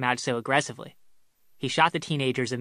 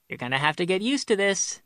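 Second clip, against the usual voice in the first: higher, lilting sing-song; sing-songy; 6 semitones higher than usual. A narrator's reading. Mother-in-law warning son-in-law of changes, sing-songy. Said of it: "Joan said in a sing-songy voice".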